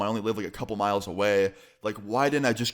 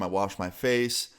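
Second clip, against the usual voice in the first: usual speaking voice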